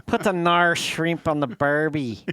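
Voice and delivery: loud, hammed-up Australian accent; broad fake Australian vowels; mock Australian accent. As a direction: imitate an Australian assistant refusing. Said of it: "a Australian voice".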